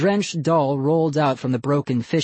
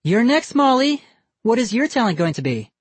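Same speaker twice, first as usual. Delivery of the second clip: higher, louder bright hostess voice; a cheery grown-up announcing the next act; 9 semitones higher, 4 dB louder than usual. A narrator's reading. Missus Barker calls up Molly's act, high and cheery.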